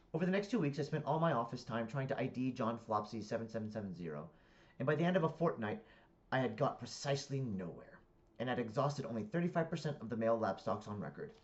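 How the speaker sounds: deliberate, projected book reading; story narration. A narrator's reading. Reader narrating a comic novel to listeners, animated narration.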